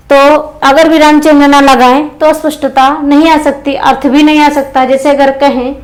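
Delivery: ordinary delivery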